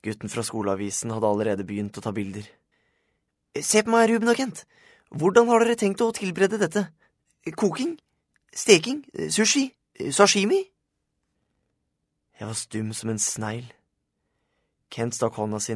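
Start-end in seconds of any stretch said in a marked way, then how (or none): 3.6-10.7 put-on very high, loud boy reporter voice; high-pitched reporter calling out to his subjects; eager school-paper reporter. A narrator's reading. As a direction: fire questions high and loud, like a pushy photographer.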